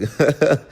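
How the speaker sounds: a short acted burst of laughter; mock laugh. Boyfriend laughing off the shirt question, mock laugh. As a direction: laugh off her question, amused. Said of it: "He started laughing like".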